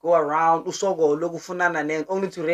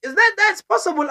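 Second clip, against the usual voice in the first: shocked disbelief; 13 semitones higher than usual. Retold or imitated. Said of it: "She was shocked when I said that".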